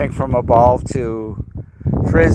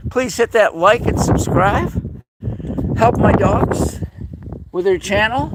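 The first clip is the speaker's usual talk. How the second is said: higher sweet voice asking for likes; cutesy high plea; 6 semitones higher than usual. Real, not acted.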